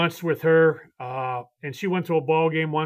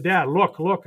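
normal talking voice

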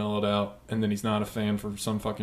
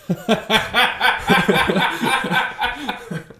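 loud, high cackling laugh; burst of laughter; 12 semitones higher, 5 dB louder than usual. Real, not acted. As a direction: crack up helplessly at the joke. Cracks up at own pun.

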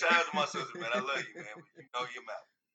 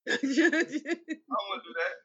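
laughing; 6 semitones higher, slower than usual. Real, not acted. Laughing through refusal.

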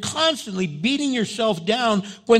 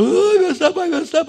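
shrill high panicked cry of I messed up; panicked wail; 11 semitones higher, 8 dB louder, faster than usual. Shown as a demonstration. Pastor mocking his own panic after a mistake, high loud wail. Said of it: "I don't sit there and go".

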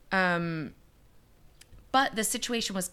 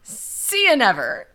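loud, slow, drawn-out put-on goodbye; mock breezy farewell; 3 semitones higher, 9 dB louder than usual. Investigators walking away from the case, loud mock farewell. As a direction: imitate a dismissive send-off, loud and stretched. Voicing investigators leaving for good.

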